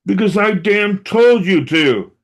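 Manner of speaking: loud, high, slow angry shout; mother yelling at her son to clean up; retold scream. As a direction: bark it like a fed-up mom. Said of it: "she would scream it at me".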